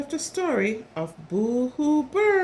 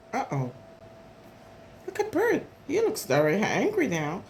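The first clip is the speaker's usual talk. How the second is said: ordinary delivery, nothing marked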